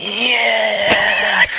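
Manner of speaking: loud, high mock battle yell; frenzied rival. Conrad punching narrator in the gut, frenzied triumphant yell. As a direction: yell it like a maniac throwing punches.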